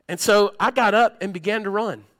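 loud, high, fervent preaching, pushing every phrase; full-force preaching. Acted. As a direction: preach it out at full power to the congregation.

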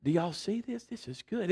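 low, hushed, underwhelmed murmur; mock understated reaction. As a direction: say it small and low, as if barely impressed. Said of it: "They don't go".

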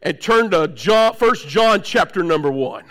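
mock formal preacher announcement; put-on churchy reading voice; pulpit voice. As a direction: intone it like a stiff preacher at the pulpit. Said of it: "But then when I get up here".

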